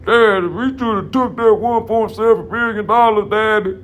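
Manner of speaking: mock a grown son begging his daddy; hammed-up high whining kid; whiny son voice. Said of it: "He like".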